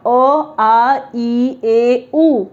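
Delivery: "अ, आ, ई, ए, उ" as pure sounds in a row; vowel sounds demonstrated. Shown as a demonstration. Teacher listing the five vowel sounds, demonstrating "अ, आ, ई, ए, उ". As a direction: recite "अ, आ, ई, ए, उ", each vowel held evenly. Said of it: "ये पांच vowel उच्चारण generally आते हैं".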